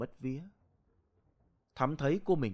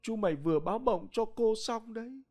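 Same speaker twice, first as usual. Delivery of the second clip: put-on old aunt's voice, lightly tearful, rising higher; sobbing quietly; 8 semitones higher than usual. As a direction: break into a mild sob as the news spills out. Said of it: "bà Hoài mới dấm dứt khóc".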